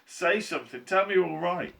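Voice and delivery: high, loud anxious plea; crying out. A narrator's reading. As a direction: beg for an answer. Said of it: "cried Missus T V".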